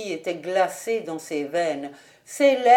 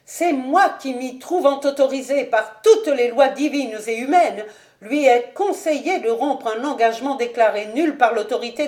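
higher-pitched, emphatic noblewoman; domineering mother; 3 semitones higher, 5 dB louder than usual. Acted. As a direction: assert your authority forcefully. Lady Ashton asserting her authority. (mère autoritaire, voix haute)

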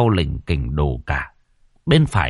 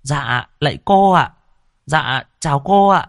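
higher-pitched, eager, respectful chorus of hello; delighted greeting; 7 semitones higher, 3 dB louder than usual. A narrator's reading. Passengers see cô Thao arrive, delighted greeting. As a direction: greet her as overjoyed followers, voice lifted. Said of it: "thì mừng rỡ".

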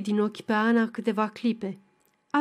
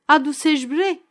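put-on louder, higher call to the boy; 4 semitones higher, 5 dB louder than usual. A narrator's reading. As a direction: shout across to the lad. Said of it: "strigă la un băiețel".

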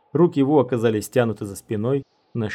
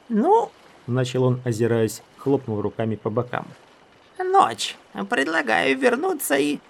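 high squeaky wizard; 9 semitones higher than usual. Acted. Wu's high voice.

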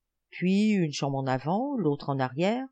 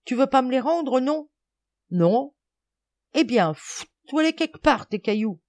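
put-on high, loud boyish bickering; heated squabble; 8 semitones higher, 5 dB louder than usual. A narrator's reading. Two boys disputing pocketfuls of stones, heated squabble. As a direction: argue back stubbornly. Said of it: "en se disputant".

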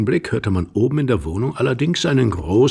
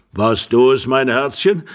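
put-on louder, ponderous old man's voice; old husband; 4 semitones higher, 7 dB louder than usual. Old husband asks his darling if it was her, ponderously. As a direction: press the question as an old husband.

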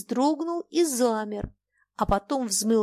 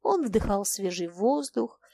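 ordinary delivery, nothing marked

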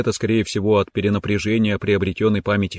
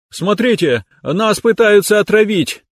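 hammed-up panic cry; mock alarm; 11 semitones higher, 5 dB louder than usual. Joking alarm about moldy cheese.

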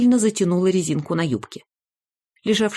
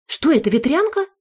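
higher, alarmed question; a mother pouncing on a clue; suspicious exclamation; 10 semitones higher, 3 dB louder than usual. A narrator's reading. One mother to grandma Bella, higher and alarmed. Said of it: "воскликнула одна из мам".

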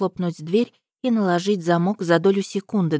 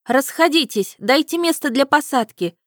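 loud woman; 7 semitones higher, 3 dB louder than usual. A narrator's reading. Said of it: "раздался громкий женский голос".

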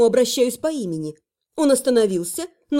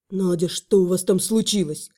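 alarmed; 7 semitones lower than usual. Acted. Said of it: "всполошился Слава".

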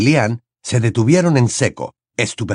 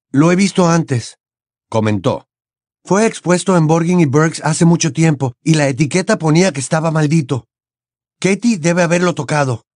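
serious; 6 semitones higher than usual. A narrator's reading. Harry explains the necklace.

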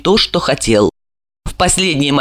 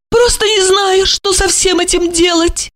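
simper it like a coquette; high mannered simper; mincing; 12 semitones higher than usual. A narrator's reading. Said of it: "слегка жеманясь".

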